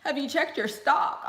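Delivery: high, loud, laughing posh chatter; schmoozing old rich people. Retold or imitated. Old people at the lounge schmoozing, high and laughing. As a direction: mimic rich old folks making small talk. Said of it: "they were schmoozing".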